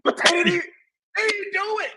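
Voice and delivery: high-pitched, choked-up wail; emotional. Real, not acted. Fan lamenting his team's second-half play calling, emotional. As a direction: voice climbing up as if about to cry. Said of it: "I'm getting emotional".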